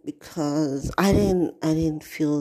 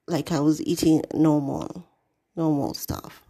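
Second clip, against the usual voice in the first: ordinary delivery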